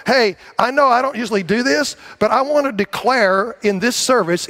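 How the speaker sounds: normal talking voice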